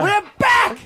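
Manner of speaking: rock star shout. Shown as a demonstration. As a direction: belt it like a frontman. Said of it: "trying to think if I can add this extra line".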